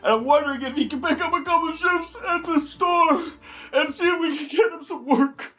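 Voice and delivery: mock emotional. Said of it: "I'm so emotional".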